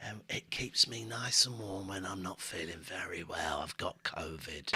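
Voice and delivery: soft whisper, no voice. Real, not acted. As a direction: whisper it weakly. Ill, dropping to whisper.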